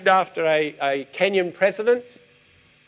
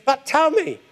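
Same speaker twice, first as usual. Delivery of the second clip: higher, insistent plea; a hint of a woman begging her family; pleading; 10 semitones higher, 4 dB louder than usual. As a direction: push to be told.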